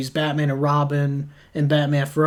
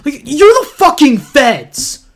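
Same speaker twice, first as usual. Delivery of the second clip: exasperated rant; 6 semitones higher, 5 dB louder than usual. Real, not acted. Ranting at the movie's characters.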